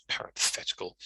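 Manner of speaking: "parenthetical" breathed softly, relishing each syllable; a soft, fond whisper of "parenthetical"; savoured word. Shown as a demonstration. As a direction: say "parenthetical" as if enjoying it. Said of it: "I just like saying that word".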